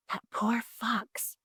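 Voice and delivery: slow, soft whisper. A narrator's reading. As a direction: whisper it so the fox won't hear. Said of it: "he whispered to his wife".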